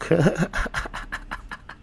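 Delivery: high, unvoiced-sounding chuckle trailing away; laugh. Real, not acted. Laughing fondly after naming his loc, soft laugh. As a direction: let a soft laugh spill out.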